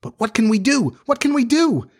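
put-on high-pitched, anxious boys' voices; helpless panic. A narrator's reading. Boys faced with wolves reply to friend, high panicky cry.

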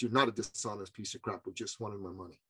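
a hint of a low-voiced, blunt customer; imitating blunt client. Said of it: "that's how he spoke".

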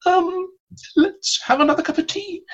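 tiny, very high-pitched hesitant voice; flustered actress. Retold or imitated. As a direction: squeak it out like an unprepared guest on air. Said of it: "She didn't have anything to say".

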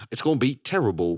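ordinary delivery

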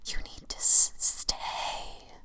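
eerie shadow whisper; dark whisper behind you. Acted. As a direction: hiss it softly from behind. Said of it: "the shadow whispered".

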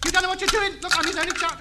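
high, panicked pleading; frantic protest. Acted. Radio ham as neighbour wrecks the set, frantic protest. Pleading as wires are pulled out.

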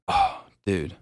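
usual speaking voice

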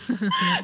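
short high-pitched giggle; a squeaky little laugh at herself; awkward high laugh. Real, not acted.

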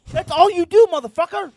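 high furious yell; angry shout. Real, not acted. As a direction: blast it at him. Roasting friend for sitting around.

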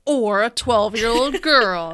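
slow, loud, staring them down; mock accusing, hard eye contact; loud mock pointed line. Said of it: "you made hard eye contact when you were like".

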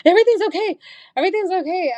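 high-pitched retold reassurance; urgent reassurance. Daughter calming her nervous mom on the phone, high and urgent.

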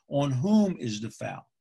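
slow, stretched "whom"; pedantic correction. Retold or imitated. Official telling coach the proper phrasing, slow stress on "whom". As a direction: lean on "whom" like a grammar stickler. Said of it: "the proper grammatical way to phrase".

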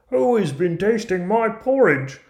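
very high, loud dwarf voice; squeaky storybook dwarf, demanding answers; indignant dwarf. Acted. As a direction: pitch it right up as a put-out dwarf. Fourth dwarf finds his porridge tasted.

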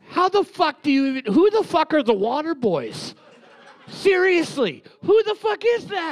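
rising, incredulous outburst with a laugh; can't believe anyone got it. Real, not acted. To friends who guessed right, incredulous outburst.

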